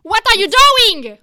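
loud, high, furious demand; angry shout. Acted. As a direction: yell it at him across the ship. Crew quarrel, one confronts another.

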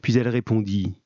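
plain reading voice

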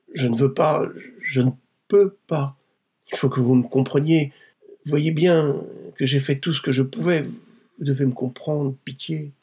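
high, anguished, halting plea; begging for pity. Acted. Wife begging her tormentor for mercy, anguished. Victim begs blackmailer for pity.

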